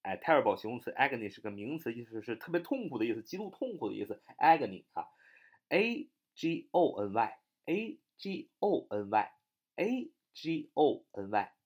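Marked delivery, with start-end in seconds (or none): 4.4-11.5 letters spelled very slowly; drawn-out letter by letter; slow spelling out. Shown as a demonstration. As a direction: spell agony slowly for the class. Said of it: "读作agony".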